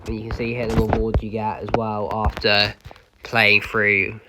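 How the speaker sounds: ordinary delivery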